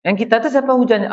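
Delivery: normal talking voice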